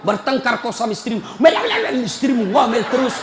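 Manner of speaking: normal talking voice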